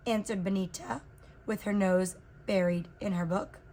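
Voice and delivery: ordinary delivery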